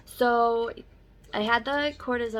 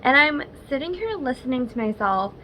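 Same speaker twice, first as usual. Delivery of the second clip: usual speaking voice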